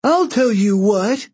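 high, slow, drawn-out cry. A narrator's reading. Octavian calls down from the rope ladder, crying out. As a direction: cry it down accusingly from above. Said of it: "cried a voice from above".

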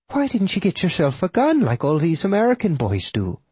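put-on high old widow character voice; questioning Irish widow. Widow asks why he carries no gun, high questioning voice.

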